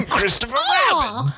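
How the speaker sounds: put-on very high childlike squeak; Christopher Robin voice. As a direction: squeak it like a little boy. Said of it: "I can do Christopher Robin".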